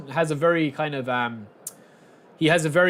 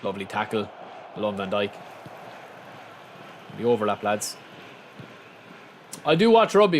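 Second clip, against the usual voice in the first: ordinary talk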